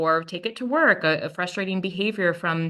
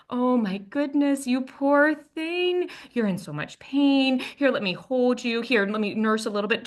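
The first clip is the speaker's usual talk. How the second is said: tender comforting mom; 4 semitones higher than usual. Retold or imitated. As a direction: coo over a hurting little one. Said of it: "totally changed from this response of".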